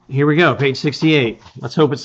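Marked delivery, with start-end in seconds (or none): none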